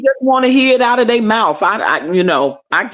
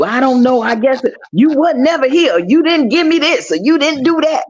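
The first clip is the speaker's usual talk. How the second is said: lightly higher, lame excuse voice; mock cheater excuse; 5 semitones higher than usual. Mocking what cheating men usually say, lightly voiced. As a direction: fumble a weak excuse. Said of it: "stupid answer, which is what y'all usually do".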